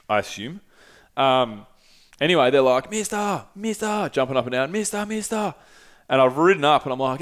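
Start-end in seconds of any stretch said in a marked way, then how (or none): 2.9-4.1 put-on high, eager child voice calling mister; imitating little kids. 4.7-5.5 put-on high, eager child voice calling mister; imitating little kids.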